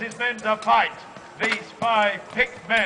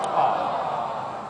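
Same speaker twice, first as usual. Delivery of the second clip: drawn-out pitying aah; sympathetic aww; 9 semitones lower than usual. Shown as a demonstration. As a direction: model the crowd's sympathy sigh. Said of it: "They go".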